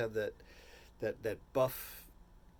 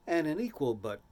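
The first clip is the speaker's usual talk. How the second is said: ordinary talk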